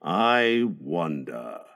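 very slow, deep, soft musing; thoughtful. A narrator's reading. Husband left doubting the nurse dog, deeply thoughtful. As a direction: sink low and quiet, lost in thought. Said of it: "Mister Darling said thoughtfully".

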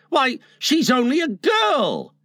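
high, protesting exclamation; aghast. A narrator's reading. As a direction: protest in shocked disbelief.

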